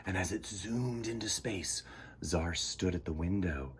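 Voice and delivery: hushed narration whisper; soft whisper. A narrator's reading. Storyteller hushing as the spaceship zooms away, whispered. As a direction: whisper it as the ship leaves.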